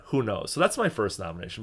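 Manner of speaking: usual speaking voice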